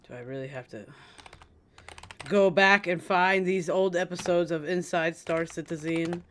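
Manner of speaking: ordinary delivery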